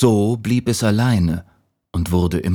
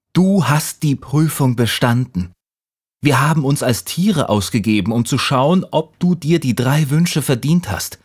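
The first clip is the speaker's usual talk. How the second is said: lightly voiced elf, higher pitched; elves; 6 semitones higher than usual. A narrator's reading. Elves grant the boy three wishes, lightly voiced. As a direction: announce with a lifted, light elfin voice. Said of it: "traf auf drei Elfen, die sagten".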